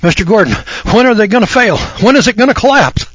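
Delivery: high, pleading caller voice; imitating worried callers pestering the host; mock anxious caller. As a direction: play an impatient listener begging for a date. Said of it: "People call here every day and they say".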